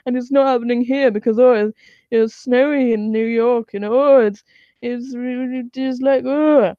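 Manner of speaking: put-on dim denier voice; mock a Fox News believer; mocking Fox viewer.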